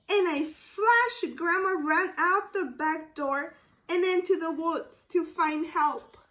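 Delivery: ordinary delivery, nothing marked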